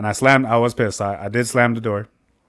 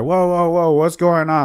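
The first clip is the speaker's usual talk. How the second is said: higher-pitched, taken-aback interjection; retold alarm; 6 semitones higher than usual. Dad reacting to the angry call, retold alarm.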